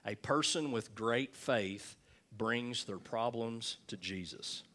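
ordinary talk

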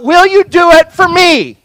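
high, loud, urgent question; pleading cry. Acted. Disciples doubting his love for them, loud high plea. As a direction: throw it out as a desperate demand.

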